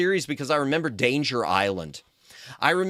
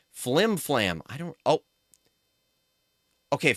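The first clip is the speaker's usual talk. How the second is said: ordinary delivery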